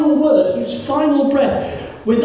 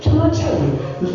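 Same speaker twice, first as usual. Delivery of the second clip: ordinary talk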